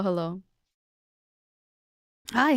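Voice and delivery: ordinary talk, nothing marked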